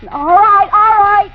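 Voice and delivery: calling to the man knocking at the door. Acted. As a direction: hold them off at the door. Calls out to the visitor.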